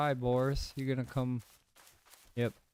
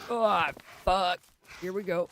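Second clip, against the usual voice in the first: being chased; 7 semitones higher than usual. Real, not acted. Both bears aggro on him.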